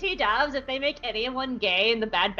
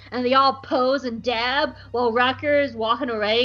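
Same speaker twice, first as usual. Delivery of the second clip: usual speaking voice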